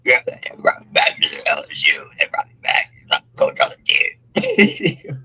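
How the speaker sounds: lightly voiced coach, breathy and odd; Lane Kiffin impression. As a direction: imitate the hired coach gloating. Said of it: "Why he sound like that".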